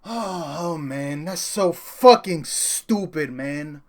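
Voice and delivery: slow, dragged-out disgust; defeated groan. Real, not acted. Streamer after losing to Homelander, slowly groaning. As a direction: groan out the loss. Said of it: "after losing live".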